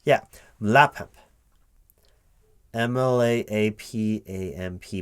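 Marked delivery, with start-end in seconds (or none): 0.6-1.1 Mlapamp said as one word, louder and higher; Mlapamp, a loud rising single word; sounding out acronym. Shown as a demonstration. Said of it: "that's what it would sound like".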